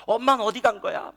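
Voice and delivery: mock complaining child. Child demands to know where mother went, mildly mock angry. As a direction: push it out as an angry kid's complaint. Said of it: "막 불평을 해요".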